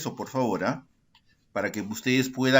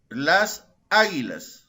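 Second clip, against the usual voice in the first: "águilas" slowed, punched on the first A; slow teacherly "las águilas", hitting the Á; stress on Á; 3 semitones higher, 4 dB louder, slower than usual. Shown as a demonstration. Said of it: "Mayor fuerza de voz en la A". ("águilas" lento, con fuerza en la primera A)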